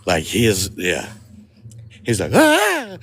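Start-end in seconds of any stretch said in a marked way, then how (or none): 2.3-3.0 short, loud mock shriek "ah"; high, sudden imitation of a scared scream; imitated high-pitched scream. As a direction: yelp very high, mimicking his scream. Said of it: "it was so high-pitched".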